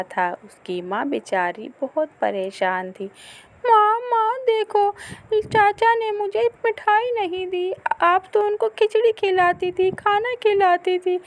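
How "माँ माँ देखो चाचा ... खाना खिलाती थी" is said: put-on high, loud complaining child; hurt little boy. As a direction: run to mother with the hurt. (माँ से ऊँची आवाज़ में शिकायत करता बच्चा)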